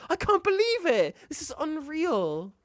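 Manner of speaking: high exclamation, stretching out the last words; incredulous. Real, not acted. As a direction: marvel at it, high and astonished. Stunned by the clip.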